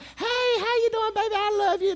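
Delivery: falsetto mock lovey-dovey voice; mock the dating fantasy in a high sweet voice; mock sweet dating talk. Mocking her idea of dating in a high sweet voice.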